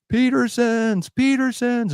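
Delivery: high, drawn-out mock yell; retold yelling. Neighbor calling to the family after the tornado, imitated yell. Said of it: "we could hear him yelling".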